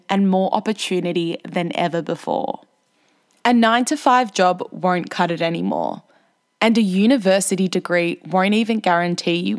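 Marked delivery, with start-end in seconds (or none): none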